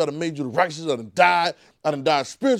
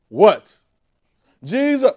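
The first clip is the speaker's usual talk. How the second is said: calling out; 6 semitones higher, 9 dB louder, much slower than usual. Pretend. Calling Jesus.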